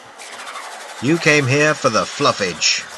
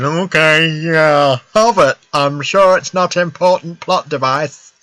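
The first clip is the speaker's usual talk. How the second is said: mock naive player; 3 semitones higher, 4 dB louder, slower than usual. Streamer mocking a bad dialogue option, mock gullible voice. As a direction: play a dim hero handing it over. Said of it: "an option that just said".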